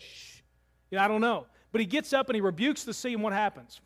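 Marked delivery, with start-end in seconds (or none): 0.0-0.4 a hushed, breathy shh; Jesus gently shushing the storm; soft shush. Retold or imitated. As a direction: hush the sea with one soft breath. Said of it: "or maybe He just said".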